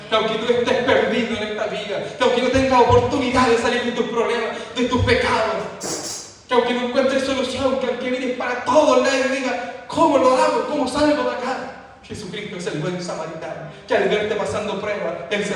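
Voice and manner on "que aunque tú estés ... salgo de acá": rising, louder preacher's build; impassioned preaching, pushing higher; preaching at full force. Acted. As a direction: build the appeal, climbing and loud.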